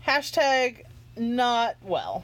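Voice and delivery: slow deadpan punchline. Real, not acted. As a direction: drag out each word dryly.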